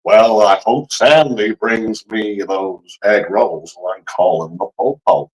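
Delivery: hammed-up slow Southern drawl; attempted Southern accent. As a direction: drag out every word in a put-on Southern accent. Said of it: "if you do a Southern, um, accent".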